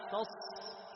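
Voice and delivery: drawn-out hiss on ص ending تص; flowing ص. Shown as a demonstration. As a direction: hold the ص of تص. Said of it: "شوف الصوت يجري معك". (إطالة صوت الصاد في تص)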